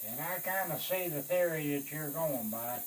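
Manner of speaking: ordinary talk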